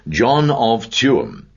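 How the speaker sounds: "John of Tuam" drawn out very slowly, each word weighed; insisting, very slow and deliberate; slow, firm repeat. A narrator's reading. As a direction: lay down "John of Tuam" slowly like a settled fact. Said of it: "repeated Mister Cunningham".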